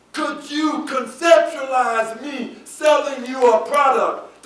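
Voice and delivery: loud, high, drawn-out preacher's cry; slow, emphatic pulpit shout; full-force preaching. Real, not acted. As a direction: preach it with indignation, leaning on the big words.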